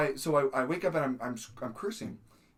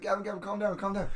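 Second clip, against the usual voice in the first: higher-pitched hurried soothing; urgent calming; 6 semitones higher, faster than usual. Retold or imitated. Acting tentmate calming the screaming sleeper, urgent calming. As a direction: imitate someone trying to settle a sleeper.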